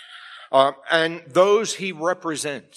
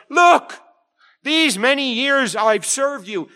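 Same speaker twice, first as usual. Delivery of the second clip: sudden shouted "Look", much higher and louder; elder brother snapping at his father, loud then slower; indignant outburst; 9 semitones higher, 5 dB louder, slower than usual. Acted. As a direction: burst out at your father in resentment. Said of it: "he's indignant".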